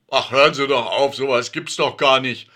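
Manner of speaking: loud, fast indignant protest. A narrator's reading. Alfred waving off the neighbour's advice, loud and fast. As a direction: brush her off, annoyed. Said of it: "protestiert Alfred".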